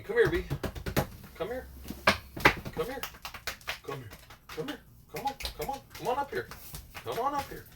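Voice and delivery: high, coaxing call to a dog. Real, not acted. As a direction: coax the dog up, sing-song. Calling the dog up.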